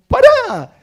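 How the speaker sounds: sudden high yell of "stop!"; sharp, high-pitched outburst; loud shout. Retold or imitated. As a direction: shout to halt a chattering child. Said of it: "Pego un grito fuerte".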